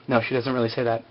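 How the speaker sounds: ordinary talk, nothing marked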